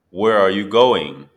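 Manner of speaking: ordinary talk, nothing marked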